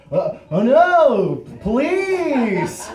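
high, loud, drawn-out fake shock; feigned surprise. Teen pretending to be clueless before cops, fake surprise. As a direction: overplay the innocent shock, stretching each word. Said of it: "pretended like I had no idea".

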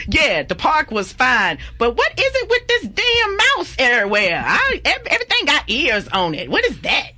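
sass the park like Shaniqua; sassy Shaniqua voice. Retold or imitated. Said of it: "Shaniqua could just come on out".

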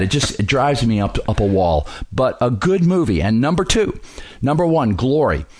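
normal talking voice

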